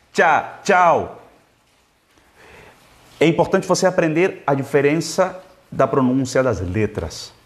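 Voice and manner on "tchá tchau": "Tchá" alone, then full "tchau", both on tch; tch sound. Shown as a demonstration. Teacher demonstrating CH for students, crisp tch sound. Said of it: "Tem essa pronúncia de tchá".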